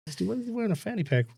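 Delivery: high, fast, hushed mock disbelief. Mocking his uncle's fanny pack with hushed disbelief. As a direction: gasp at it in disbelief. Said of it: "You're like".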